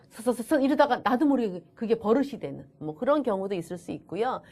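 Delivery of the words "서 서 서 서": copying a stammer on "서"; rapid repeated "서", never finishing the word; mimicked stutter. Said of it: "걔 흉내 낸다고".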